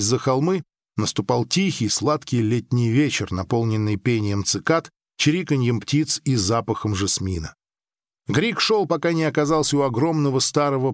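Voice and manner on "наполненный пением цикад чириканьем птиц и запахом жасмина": ordinary delivery, nothing marked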